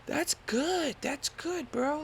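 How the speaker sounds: high, strained nervous-dude voice; imitating himself nervous. Nervous jumper answering the instructor, in a high strained voice. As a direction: squeak out a nervous reassurance. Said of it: "And I'm like".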